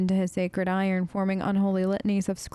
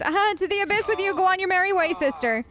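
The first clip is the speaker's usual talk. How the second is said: higher, louder, fast mock bravado; hammed-up cultist dismissal; 11 semitones higher, 3 dB louder than usual. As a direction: brush them off theatrically.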